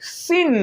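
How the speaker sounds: mocking "સિન" said short, high and sharp like a know-it-all; sarcastic high "સિન"; mock wrong pronunciation. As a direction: tease the pupils who say "સિન" instead of sine. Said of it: "અમુક મહાપુરુષનું અંગ્રેજી બહુ powerful".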